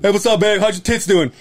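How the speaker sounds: imitating a guy greeting his wife, pitched high; mock phone greeting. Coworker on the phone to his wife, mock cheery greeting.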